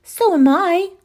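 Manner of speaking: put-on little girl's high eager cry; cried out. A narrator's reading. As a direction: pipe up eagerly like a small girl. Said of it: "cried Louisa".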